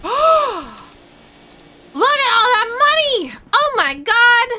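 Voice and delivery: loud high excited cry; excited shout. Real, not acted. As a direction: shout with real delight. Finds a pile of money.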